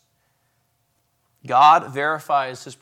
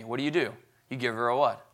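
ordinary talk, nothing marked

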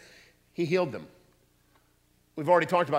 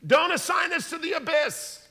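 pleading demon; 5 dB louder than usual. Acted. Demons begging Jesus.

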